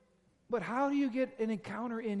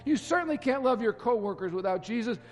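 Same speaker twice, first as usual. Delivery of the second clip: louder, higher fervent preaching; full-force preaching; 4 semitones higher, 8 dB louder than usual. Real, not acted. Pastor exhorting his congregation, fervent loud preaching. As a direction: preach it out with rising urgency.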